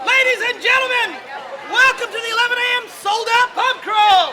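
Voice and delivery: high, loud showman welcome; announcer shout. Real, not acted. Host welcoming the pub crawl crowd, announcer shout. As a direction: open the show like a ring announcer.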